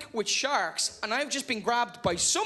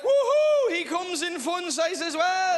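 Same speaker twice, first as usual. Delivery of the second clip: high delighted woohoo, then slower; excited shark whoop; 8 semitones higher, 6 dB louder, slower than usual. Retold or imitated. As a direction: whoop like a shark spotting a snack. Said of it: "they're like".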